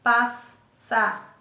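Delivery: PAS - SA - POR - TE, slow with clear gaps; teacher sounding out PAS-SA-POR-TE; split between the S's. Shown as a demonstration. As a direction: say passaporte divided as PAS, SA, POR, TE. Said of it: "você separa os dois S".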